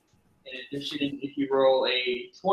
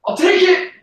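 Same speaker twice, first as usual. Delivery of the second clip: much higher and louder; excited cheer; 12 dB louder than usual. Real, not acted. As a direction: shout it in triumph. Player cheers at roll.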